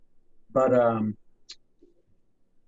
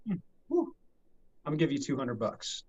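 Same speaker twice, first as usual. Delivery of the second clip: smug lowball offer; 5 dB quieter than usual. Retold or imitated. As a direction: play it cool and cheap. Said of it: "Will Ferrell looks at him".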